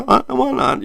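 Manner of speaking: jumps way up high, breezy; upbeat why-not. Retold or imitated. Retelling his own choice to take the truck, jumping high. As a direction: toss it off like an easy yes. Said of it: "and I said".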